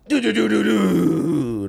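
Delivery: slow, drawn-out "doo" sound effect; imitated car breakdown noise. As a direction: mimic the car winding down. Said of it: "it just went".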